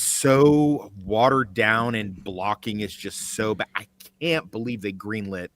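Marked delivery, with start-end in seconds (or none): none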